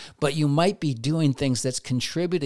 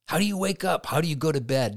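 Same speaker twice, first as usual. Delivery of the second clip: ordinary delivery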